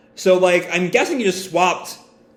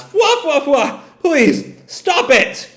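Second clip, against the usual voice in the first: high "fwa fwa" laughs then higher pleading; helpless laughing; 10 semitones higher, slower than usual. Acted. Killer laughing while begging Hawkins to stop. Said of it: "And Killer laughs".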